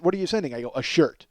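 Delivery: ordinary talk, nothing marked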